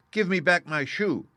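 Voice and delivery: put-on high, loud angry child demanding her shoe; Dorothy snaps at the witch to give it back. A narrator's reading. Dorothy angrily demanding her shoe from the witch. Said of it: "grew angry and said to the witch".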